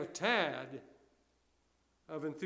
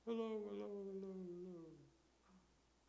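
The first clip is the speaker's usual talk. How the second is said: soft, higher echo fading away; imitated echo; 4 semitones higher, 11 dB quieter, much faster than usual. Preacher mimicking the canyon's answer, fading echo. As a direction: repeat it softly like a canyon echo. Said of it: "it comes back".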